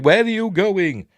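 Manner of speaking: high, loud fretful droid call; imitation of an anxious droid; C-3PO. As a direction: call after a departing friend, pitched high. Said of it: "with three P O saying".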